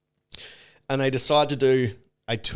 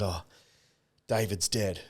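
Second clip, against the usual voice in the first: low, soft, subdued retelling; imitated sadness; 4 semitones lower, 8 dB quieter than usual. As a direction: break the news quietly, sadly. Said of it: "She came out sad".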